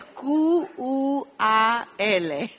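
T U R spelled out slowly, each letter apart and loud; spelling letter by letter. Shown as a demonstration. Teacher spelling the syllable to students, slow. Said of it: "spelling syllable".

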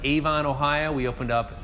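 normal talking voice

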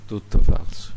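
hissed whisper of a deceiving enemy; the enemy's whisper. Acted. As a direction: whisper it like a tempter sowing doubt. (sussurro del nemico tentatore)

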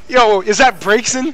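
loud, slow surprised question. Real, not acted. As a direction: react in disbelief. Surprised at character pick.

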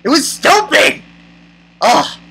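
high, emphatic annoyance; exasperated verdict. Real, not acted. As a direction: hit it hard, fed up.

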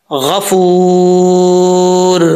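गफूर very slow, heavy gh and long held oo; full ghayn. Shown as a demonstration. Teacher modelling the word for students, drawn-out recitation. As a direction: draw out the oo of गफूर at length. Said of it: "हरूफ मुस्ताला में से है".

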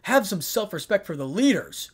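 annoyed. A narrator's reading. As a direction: scold a brother with annoyance. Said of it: "spoke clearly, annoyed by her brother's attitude".

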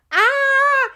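very high, loud squeal; frightened squeal. A narrator's reading. Gerti stumbles over her feet, startled high squeal. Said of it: "quiekte Gerti da".

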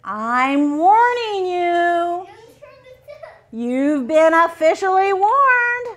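slow, looming monster threat; monster warning. Acted. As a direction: warn them like a grumpy monster. Monster warns readers in book.

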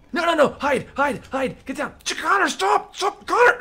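very high, frantic squealed warnings; mock panic. Player begging Connor to hide and stop, high-pitched panic.

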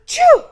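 gasping intake then a huge loud choo; giant sneeze. A narrator's reading. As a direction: sneeze it enormously. Snowman sneezed a gigantic.

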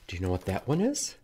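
ordinary delivery

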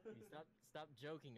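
usual speaking voice

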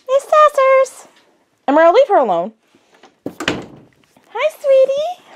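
high sing-song pet talk. Real, not acted. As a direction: coo at the dog. Greeting her dog.

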